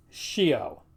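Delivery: scio as "shee-o". Shown as a demonstration. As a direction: say scio opening on a sh sound. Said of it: "in front of E or I".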